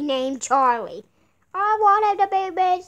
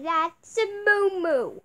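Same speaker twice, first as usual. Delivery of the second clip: ordinary talk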